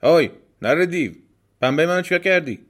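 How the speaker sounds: loud, high, rude holler; high-pitched indignant shouting; demanding shout. Acted. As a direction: yell a bold demand at a sleeping demon. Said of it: "داد زد".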